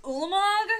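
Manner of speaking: high, loud announcing the win; triumphant call. Retold or imitated. To opponents at the table, a loud triumphant call. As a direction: proclaim it like victory is sealed.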